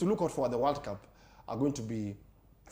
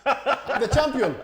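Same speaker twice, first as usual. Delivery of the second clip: boastful; 8 semitones higher, 5 dB louder, much slower than usual. Real, not acted. Boasting about trivia wins.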